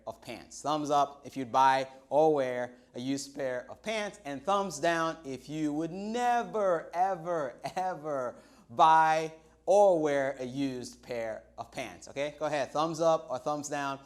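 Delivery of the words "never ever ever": slow, drawn-out never, ever, ever; each ever dragged out slowly; stress on never, ever. Real, not acted. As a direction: lean hard on every ever. Stressing she would never buy them.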